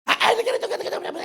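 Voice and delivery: high, squealing mock chatter trailing into a breathy whisper; mock gossip voice. Pastor mimicking a churchgoer gossiping online, mock squeal. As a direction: make fun of a Facebook poster. Mimics someone posting on Facebook.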